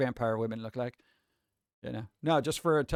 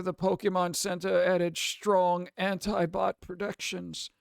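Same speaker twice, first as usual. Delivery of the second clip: put-on high, slow elderly reading voice; old person voice; 4 semitones higher than usual. Shown as a demonstration. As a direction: read it like an old man. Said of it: "let me do old person voice".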